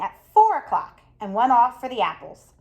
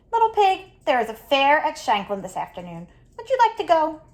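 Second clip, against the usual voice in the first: higher, coaxing wolf voice luring the pig; sly sweet wolf; 4 semitones higher than usual. Acted. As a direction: lure him in with a sugary lilt.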